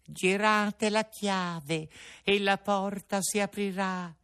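small cricket voice from within; tiny voice. Acted. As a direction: call from inside like a little creature. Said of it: "disse la solita vocina".

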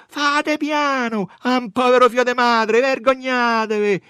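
loud, high-pitched protest; outraged shouting. A narrator's reading. Women pleading for a poor mother's son, high loud shouting. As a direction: cry out to shame them.

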